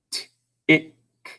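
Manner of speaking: isolated 't', 'it', 'k' with gaps; sound by sound. Shown as a demonstration. Teacher sounds out a syllable, sound by sound. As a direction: pull the syllable apart into single sounds. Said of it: "We're sounding it out".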